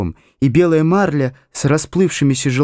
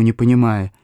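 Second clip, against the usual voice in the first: plain reading voice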